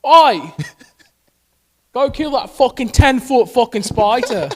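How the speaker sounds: loud, high-pitched Aussie holler; hammed-up Australian spider alarm; mock Australian. Joking that Australians wrestle beasts, mock Australian. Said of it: "I believe that".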